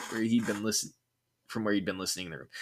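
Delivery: ordinary delivery, nothing marked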